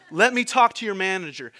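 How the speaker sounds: loud, indignant demand for the manager; demanding customer. Retold or imitated. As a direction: push like an irate customer.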